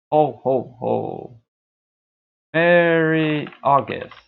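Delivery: loud, drawn-out mock Santa greeting. Streamer greets viewers as Santa in August, jolly mock Santa. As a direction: belt it out high and slow like Santa.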